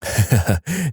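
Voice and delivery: brief acted chuckle; quick amused laugh; short laugh. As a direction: laugh lightly down the phone. Cousin laughs in acted phone call.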